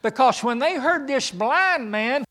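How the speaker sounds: loud, high, fervent preaching; preaching at full force. Acted. Preacher rousing the congregation, loud and high. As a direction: drive it home to the congregation.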